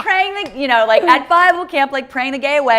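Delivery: high, loud sarcastic tone; mocking. Real, not acted. Mocking the Bible camp to his friend, high and loud. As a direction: scoff at the Bible camp idea.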